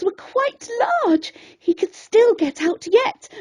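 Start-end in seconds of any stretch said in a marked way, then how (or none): none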